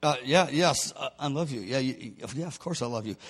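very soft, low, halting mutter; mumbling. Acted. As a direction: mumble evasively, barely letting it out.

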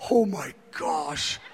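very low, slow, hushed wonder; soft retold near-tears amazement; tearing up, awestruck. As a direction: murmur it as if overcome. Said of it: "I begin to tear up".

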